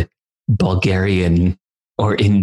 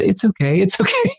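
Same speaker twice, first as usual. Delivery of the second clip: put-on high, soothing childlike "it's okay", rising even higher; imitating his son; 9 semitones higher than usual. Father imitating his son comforting a kid who fell, high childlike voice. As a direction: comfort a fallen kid in a tiny sweet voice. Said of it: "put his arm around him and said".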